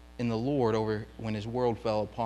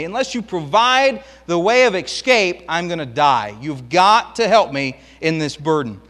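loud, higher anguished begging; voicing David crushed under his burden, pleading; desperate plea; 5 semitones higher, 9 dB louder than usual. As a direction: beg God to rescue you from dying. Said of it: "David is saying this".

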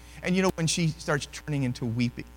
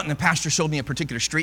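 usual speaking voice

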